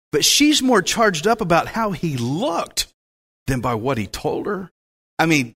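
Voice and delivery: normal talking voice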